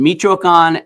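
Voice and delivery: Michoacan said loud and slow, stress on the final can; Spanish Michoacan. Shown as a demonstration. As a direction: say Michoacan the Spanish way, accent at the end. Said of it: "There's an accento in the, at the end".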